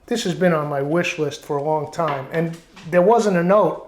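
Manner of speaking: normal talking voice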